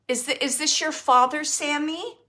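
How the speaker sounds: usual speaking voice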